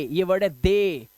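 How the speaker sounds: ordinary talk, nothing marked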